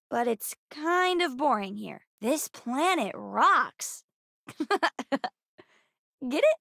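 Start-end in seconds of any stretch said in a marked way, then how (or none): 4.5-5.0 short, higher-pitched laugh; little joking giggle. Acted. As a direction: laugh at your own joke. Laughs at own joke.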